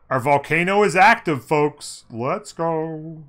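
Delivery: loud, high, hyped-up call; excited announcement. Real, not acted. Streamer telling folks the volcano is active, excited. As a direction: crow it to the audience. Exclaims at volcano erupting in game.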